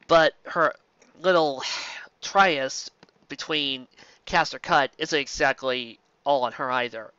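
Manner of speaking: plain reading voice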